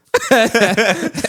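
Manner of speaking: big burst of laughter; loud laugh. Real, not acted. Laughs at shoe joke.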